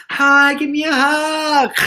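high, drawn-out mock greeting; gushing greeting. Acting out hugging a fan, gushing. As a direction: gush it slowly, play-acting a reunion.

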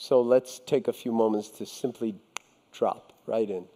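guided meditation. Real, not acted. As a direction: guide the listeners gently inward, pitch easing down. Leads a short meditation.

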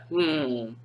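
low, sly purr; the cat watches Fred, low and sly. Acted. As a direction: eye the gingerbread man slyly, in a low voice.

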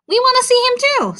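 very high, loud eager little insects; tiny dragonfly voices. Acted. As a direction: chirp it up high like buzzing dragonflies. Two dragonflies chime in.

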